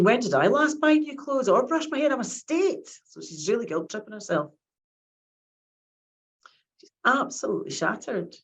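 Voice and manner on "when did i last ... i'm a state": voicing a frazzled mum, pitched higher; mock despair of a worn-out mum; exhausted mum's thoughts. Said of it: "she's thinking".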